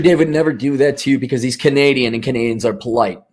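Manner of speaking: ordinary delivery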